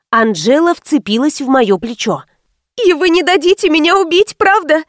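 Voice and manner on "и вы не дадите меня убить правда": high-pitched, anxious plea; pleading. A narrator's reading. Анжела clutching her protector's shoulder, high anxious plea.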